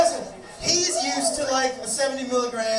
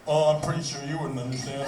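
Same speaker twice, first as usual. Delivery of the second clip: stoned guy; 8 semitones lower, 4 dB quieter than usual. Retold or imitated. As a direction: imitate a stoner guarding his big secret, low. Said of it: "when you're that high".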